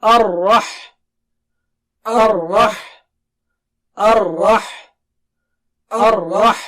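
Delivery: Ar-Rah with a thick ra and an audible ha; loud drill of Ar-Rah. Shown as a demonstration. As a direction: join Ar-Rah, ra heavy, ha breathed clear. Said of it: "Now join it with Ha".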